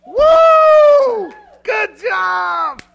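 full-throated stadium cheer; cheering parent. Pretend. Proud parent roaring from the bleachers, full shout. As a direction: yell it like your kid just scored. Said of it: "do you get up and you go".

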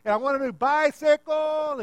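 high, loud imitation of a screaming boy; hammed-up yelled prayer; top-of-lungs scream. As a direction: shout the wish at full volume. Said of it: "screaming at the top of his lungs".

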